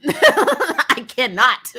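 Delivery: ordinary talk, nothing marked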